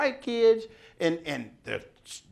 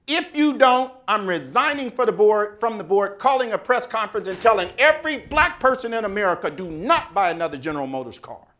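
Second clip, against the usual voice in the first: a much louder, commanding ultimatum; forceful demand; 3 semitones higher, 7 dB louder than usual. Retold or imitated. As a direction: lay down the law, rising in force. Sullivan's demand to the chairman.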